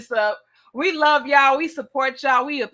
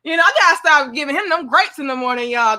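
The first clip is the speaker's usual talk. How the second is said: ordinary delivery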